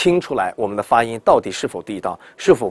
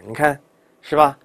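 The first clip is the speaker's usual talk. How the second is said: normal talking voice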